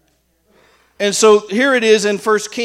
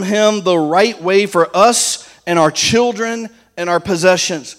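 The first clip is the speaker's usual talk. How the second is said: solemn scripture reading; 5 dB louder than usual. Acted. Reads Ezra scripture aloud.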